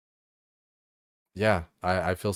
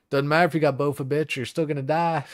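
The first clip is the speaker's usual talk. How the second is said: mocking taunt; 7 semitones higher than usual. Acted quote of a mocking chatter.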